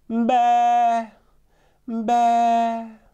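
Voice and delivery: high, loud bleat; goat bleating. A narrator's reading. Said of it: "Tóbiás meg is szólalt".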